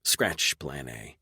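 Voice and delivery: usual speaking voice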